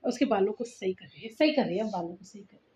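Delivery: low, soft trailing commentary; quiet aside. Real, not acted. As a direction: murmur it almost to yourself. Murmured commentary on child fixing hair.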